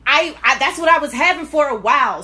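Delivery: usual speaking voice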